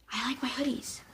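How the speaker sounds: retold answer in a whisper; hushed retelling of a small defiant answer; whispered reply. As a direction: murmur it back to mom stubbornly. Her answer to mom, retold.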